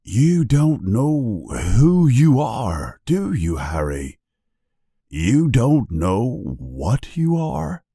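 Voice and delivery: slow, dismayed disbelief; understated, stretched-out dismay; mildly sad. A narrator's reading. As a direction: draw out the questions with a touch of sorrow. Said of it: "says Hagrid sadly".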